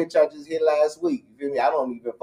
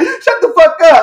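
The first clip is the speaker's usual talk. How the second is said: laughing yell; 12 dB louder than usual. Real, not acted. Laughing protest at a tease.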